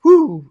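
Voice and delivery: sudden loud, high exhale; retold overwhelmed whoo; high whoop of overwhelm. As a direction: let out a high "woo" of release. Reliving being overwhelmed by crying.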